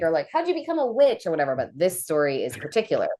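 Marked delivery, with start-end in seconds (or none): 0.3-1.3 much higher, mock-curious asking voice; curious asker. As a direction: imitate eager people asking. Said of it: "People usually ask".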